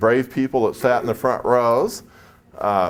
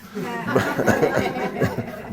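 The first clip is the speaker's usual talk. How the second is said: breathy laugh; 3 semitones higher than usual. Real, not acted. Laughs at his win.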